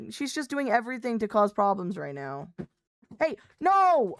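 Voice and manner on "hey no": sudden high, loud "Hey, no!" at the cat; sharp shout. Real, not acted. Streamer yelling at her cat knocking over water, loud and sharp. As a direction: yell to stop the cat in its tracks.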